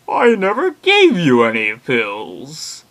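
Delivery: loud, slow, theatrical quote; hammed-up booming doctor, stretching every word; overblown, drawn-out denial; psychiatrist's reply.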